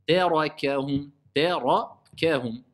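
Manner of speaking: slow Tarakahum, light "tè", heavy "ra", light "kè"; measured Tarakahum with alternating vowel weight; thin, heavy, thin. Shown as a demonstration. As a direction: recite Tarakahum slowly, darkening only the ra. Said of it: "Ta, muraqqaqa".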